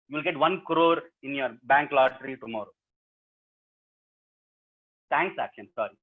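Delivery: plain reading voice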